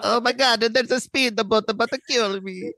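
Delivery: high, panicky cartoon Italian accent; mock Italian accent. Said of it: "Italian accents are what I assume".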